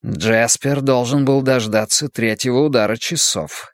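usual speaking voice

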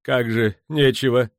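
agitated outburst; indignant exclamation. A narrator's reading. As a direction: exclaim it in alarm. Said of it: "воскликнул кожевенник".

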